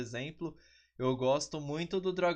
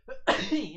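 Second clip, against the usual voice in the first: a real sneeze; one sharp loud sneeze; sneezing; 6 semitones higher, 6 dB louder than usual. Real, not acted. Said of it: "desculpem ter espirrado".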